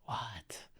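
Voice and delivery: breathless whispered disbelief; a barely-there whispered what; stunned whisper. Real, not acted. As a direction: whisper it in shock, falling away.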